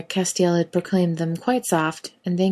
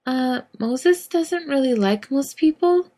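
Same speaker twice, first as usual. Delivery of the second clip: put-on hesitant girl; 8 semitones higher, slower than usual. Girl warns Dean.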